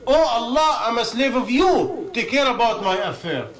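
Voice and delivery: loud, high plea to Allah, easing at the end; acted fervent plea. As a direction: call out imploringly, starting high. Acting out a companion's prayer.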